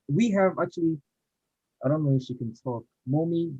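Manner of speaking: normal talking voice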